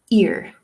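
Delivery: "ear" ending on a strong R; Irish pronunciation. Shown as a demonstration. Teacher repeating own version, strong Irish R. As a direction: sound the final R of "ear" strongly. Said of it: "pronounce our R's very strongly".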